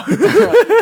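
loud high laugh. Real, not acted. Hearing the co-host's joke, bursting out laughing.

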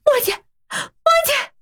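high, crying shout; calling out. A narrator's reading. Bai Xin shouts for his friend in the silent room, desperate. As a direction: call out her name desperately. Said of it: "大声喊着".

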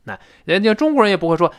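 normal talking voice

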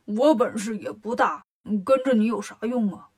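put-on character voice, grudging; reluctant Bajie. Pig disciple balks at joining his brother, grudging character voice. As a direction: grumble your way out of the job in an altered voice. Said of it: "八戒不乐意".

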